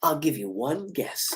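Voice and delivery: low, hushed, drawn-out menace; slow, soft phone taunt; Ghostface. Acted. Killer toys with Gail on the phone, low and menacing. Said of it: "getting a call from Ghostface".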